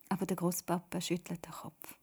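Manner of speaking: ordinary delivery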